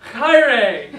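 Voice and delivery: loud high mock greeting; bright call of Kyrie. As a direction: sing out a cheerful greeting. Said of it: "they say".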